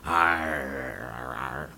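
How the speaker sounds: low, mock pirate growl.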